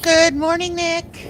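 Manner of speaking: bright sing-song hello; cheerful high greeting. Real, not acted. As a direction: greet the chat warmly. Greeting a chat member.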